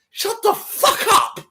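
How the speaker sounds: high, slow shouted insult; mock gym bro shout. Mimicking a gym bro yelling at a weaker lifter, loud shout. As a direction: bellow it like a hyped gym bro. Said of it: "It's like".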